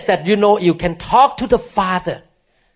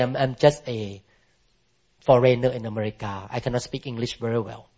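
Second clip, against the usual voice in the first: softer, shrinking apology; meek and intimidated; 4 semitones lower, 6 dB quieter than usual. Retold or imitated. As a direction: mumble it like a timid foreigner. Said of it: "feel intimidated".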